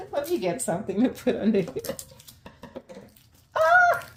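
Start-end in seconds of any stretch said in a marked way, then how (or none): 3.6-4.1 very high, loud delighted "ah"; excited squeal. Real, not acted. Unboxer seeing her surprise plant, excited squeal. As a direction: let out a real high squeal. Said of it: "I'm so excited".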